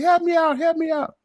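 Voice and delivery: high-pitched mocking plea; mock screaming for help. Imitating the woman down in the hole, high mock scream. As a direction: whine it up high like someone stuck in a hole. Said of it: "you be hollering, screaming".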